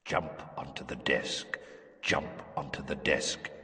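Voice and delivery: low, soft and far away; a voice echoing in an empty head; distant echoing voice. Acted. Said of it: "voice echoing in some distant chamber".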